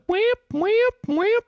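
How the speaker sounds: high-pitched repeating alarm whoops; piercing "whip" siren imitation; imitated low-health alarm. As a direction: mimic a nagging game beeper, each whoop swooping up. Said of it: "it's like".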